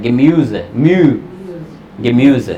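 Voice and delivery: गेम्यूज with rounded ü on म्यू; umlaut sound. Shown as a demonstration. Teacher isolates the umlaut in गेम्यूज. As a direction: break out म्यू then say गेम्यूज whole. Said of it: "जो umlaut का sound होता है".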